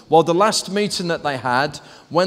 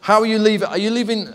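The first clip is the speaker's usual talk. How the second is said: usual speaking voice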